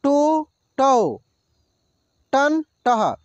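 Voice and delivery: टो, टौ drawn out slowly; slow syllable demo. Shown as a demonstration. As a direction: stretch टो and टौ apart. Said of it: "टे, टै". (टो, टौ धीरे खींचकर)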